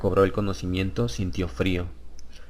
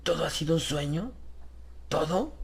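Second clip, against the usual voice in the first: much higher, softer disbelieving question; hoping it was all a dream; dazed Harris; 7 semitones higher, 4 dB quieter than usual. Acted. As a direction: Harris pleading softly with the stranger.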